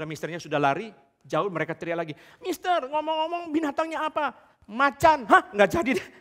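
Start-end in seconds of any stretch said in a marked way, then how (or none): 2.4-4.3 high call across a distance; shouting after him. Acted. Youths shout after running foreigner. 4.7-5.2 voicing foreigner. Foreigner answers tiger. 5.3-6.1 loud, high startled hah; startled backing out. Acted. Youths back out in fright.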